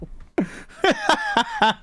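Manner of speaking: high-pitched real giggle; helpless high laughter; giggling. Real, not acted. Amused at own plot choice.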